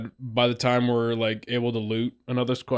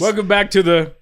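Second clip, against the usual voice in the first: shouted intro; 8 semitones higher, 8 dB louder than usual. Acted. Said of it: "just say dude man duos".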